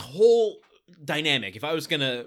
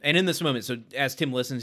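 ordinary talk

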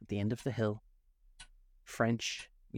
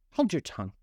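loud, curt order; sharp command; 6 semitones higher, 4 dB louder than usual. A narrator's reading. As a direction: snap it out loudly. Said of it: "added the Griffin".

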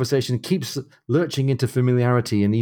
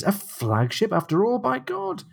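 high, rising burst of amazement; exclaiming as a character; acted exclamation; 12 semitones higher, slower than usual. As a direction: let the oath leap up high. Acting Jack's exclamation while recanting.

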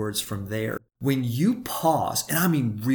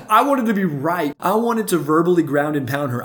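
laughing; 6 semitones higher, 6 dB louder than usual. Real, not acted. Admits wanting to win argument.

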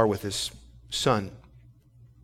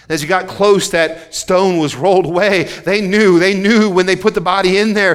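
raised and higher, pressing each line; loud preaching; 6 semitones higher, 7 dB louder than usual. Acted. Preacher reading Luke then retelling the crucifixion, loud and insistent. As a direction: proclaim it to the back row. Preacher climbs again at the tomb.

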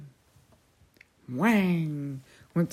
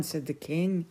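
plain reading voice

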